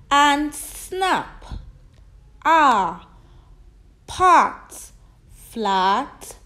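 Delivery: teacher drilling A words loudly. Shown as a demonstration. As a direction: chant the words, stressing the A. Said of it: "the A sound as in".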